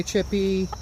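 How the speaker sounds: ordinary talk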